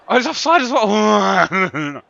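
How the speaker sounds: high, loud, slow cry of dismay; exasperated wail. Real, not acted. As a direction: wail it out loudly in frustration. Groans at further bad news.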